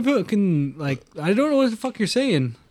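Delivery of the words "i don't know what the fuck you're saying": ordinary talk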